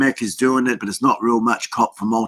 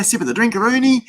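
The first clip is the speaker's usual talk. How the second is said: higher again, goofy stretch on drinkaroonie; silly drinkaroonie; 10 semitones higher, faster than usual. Real, not acted. Host jokingly naming his drink, goofy high lilt. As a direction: ham up the silly word drinkaroonie. Playful drink sip on stream.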